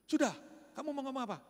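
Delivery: impatient man daring him to speak; impatient retort. Retold or imitated. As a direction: brush him off quickly. Man snaps back at pastor.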